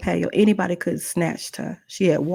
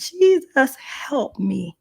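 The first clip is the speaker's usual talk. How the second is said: calling on Jesus; 8 semitones higher, much slower than usual. Retold or imitated. Woman prays for rescue, desperate cry sinking to plea. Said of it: "I called on the name of Jesus".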